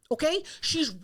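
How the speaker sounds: usual speaking voice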